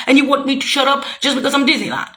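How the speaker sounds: ordinary talk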